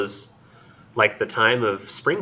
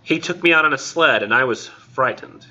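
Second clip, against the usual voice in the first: lilting, higher recital; poem reading; 5 semitones higher than usual. Acted. Lecturer reading the sled memory to class, poem reading. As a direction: read the remembered childhood scene as verse.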